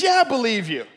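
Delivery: loud, high, eager reply; desperate shouted answer. Acted. Man on the branch assures God, shouting. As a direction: play a man agreeing in desperation.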